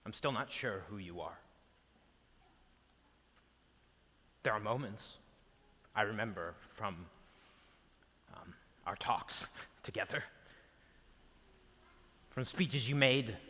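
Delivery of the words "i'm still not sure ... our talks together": softer, lower, with long pauses; hushed, halting grief. Acted. Peter remembers talks with his lost teacher, hushed and halting. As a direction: search quietly through painful memories. Grieving monologue to dead teacher.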